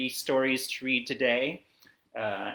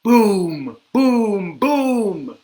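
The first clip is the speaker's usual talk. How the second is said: loud, high, falling booms; thunder booms; 8 semitones higher, 13 dB louder, much slower than usual. Acted. Reader makes thunder for kids, booming loudly. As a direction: boom it out like thunder. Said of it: "Mister Brown makes thunder".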